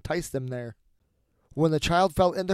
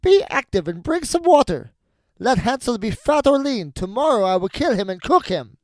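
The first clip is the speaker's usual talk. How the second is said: high, loud witch commands; impatient witch; 7 semitones higher, 7 dB louder than usual. A narrator's reading. Witch will wait no longer, impatient high shouting. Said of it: "she was seized with impatience".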